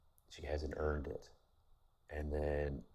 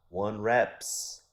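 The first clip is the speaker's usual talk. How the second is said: put-on heavy Persian accent, louder and higher; 5 semitones higher, 9 dB louder than usual. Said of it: "heavy, heavy Persian accent".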